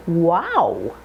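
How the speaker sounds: "Wow" with a wide opening jaw glide; teacher exaggerating the ow sound; big jaw movement. Shown as a demonstration. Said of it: "Really work the jaw".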